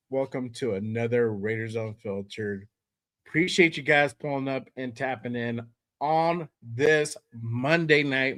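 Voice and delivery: usual speaking voice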